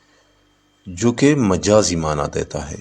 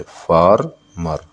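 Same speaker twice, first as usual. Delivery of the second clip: "Farmer" slowly as फार, pause, मर; syllable by syllable; much slower than usual. Shown as a demonstration. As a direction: break "farmer" into two beats. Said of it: "farmer में दो syllable है". ("Farmer" को फार... मर, अलग-अलग)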